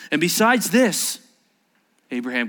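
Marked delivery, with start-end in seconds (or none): 0.1-1.2 raised, loud preacher's voice; impassioned preaching. Acted.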